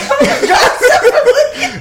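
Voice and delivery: exasperated. Retold or imitated. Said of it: "I used to be like".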